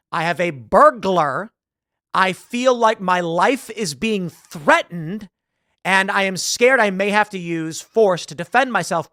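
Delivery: slow, deliberate acted police call; measured, weighty statement of danger, acted; emphatic emergency report. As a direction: spell it out firmly for the dispatcher.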